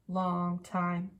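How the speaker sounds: plain reading voice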